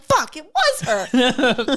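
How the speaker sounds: slow, loud, laughing groan; laughing realization. Retold or imitated. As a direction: drag it out through laughter. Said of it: "you're like".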